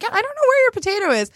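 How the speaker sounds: high, quick offhand waitress. Retold or imitated. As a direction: toss it off like a busy waitress. Waitress quoted.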